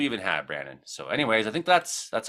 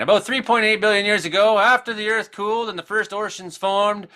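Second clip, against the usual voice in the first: lightly voiced Jesus, pitched up; high-pitched Jesus voice; 10 semitones higher, 3 dB louder than usual. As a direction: read it like a preacher narrating. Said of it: "he's saying".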